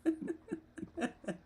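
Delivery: high breathy giggle; giggling. Real, not acted. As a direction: giggle at the meme. Laughing at the meme.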